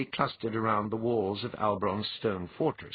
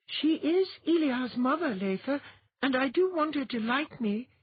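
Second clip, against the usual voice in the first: put-on tiny girl. Girl character voice.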